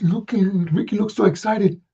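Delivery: plain reading voice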